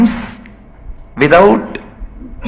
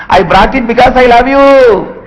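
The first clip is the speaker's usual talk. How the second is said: much higher and louder, rushed out; blurted confession; 12 semitones higher, 6 dB louder than usual. Acted. Shy cub confessing love to the lion, high excited blurt. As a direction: blurt it and flee.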